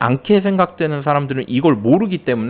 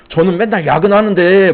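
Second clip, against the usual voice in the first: high, louder whining put-on voice; whiny tattletale; 5 semitones higher, 6 dB louder than usual. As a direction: whine to the boss about your overtime. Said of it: "막 이러고". (사장에게 일러바치는 징징대는 목소리)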